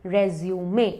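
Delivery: रेज्यूमे ending in "may"; three parts in रेज्यूमे, final "may"; French-derived pronunciation. Shown as a demonstration. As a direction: say रेज्यूमे as "rez-yoo-may". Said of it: "इसका pronunciation है रेज्यूमे".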